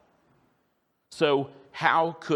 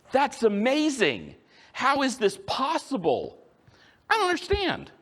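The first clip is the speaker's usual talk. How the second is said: amazed wonder; 14 semitones higher than usual. Retold or imitated. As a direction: marvel at it like a stunned girl. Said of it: "Whereas Mary, it's more".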